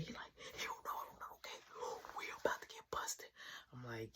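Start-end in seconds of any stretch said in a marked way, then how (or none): 0.6-3.3 hushed, fast whisper; scared hurried whisper; whispered panic. Retold or imitated. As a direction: whisper it quick so nobody hears. Brother whispers, hiding from family.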